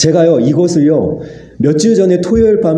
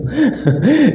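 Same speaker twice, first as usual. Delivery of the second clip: a light, high chuckle; short rising laugh; 7 semitones higher than usual. Retold or imitated. God amused at the pastor's report card, light laugh. Laugh inside God's quote.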